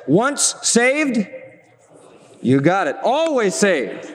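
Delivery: slow, drawn-out slogan as once heard; mock folksy catchphrase; old catchphrase. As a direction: intone it like a remembered saying. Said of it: "I used to hear it this way".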